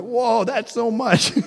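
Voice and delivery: put-on high, slow, amazed little-boy whoa; awed child. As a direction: let it blow his little mind. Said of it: "blows his little mind".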